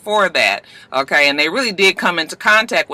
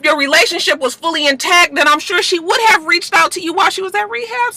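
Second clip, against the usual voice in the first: heated rant; 10 semitones higher than usual. Real, not acted. Calling out Cisco.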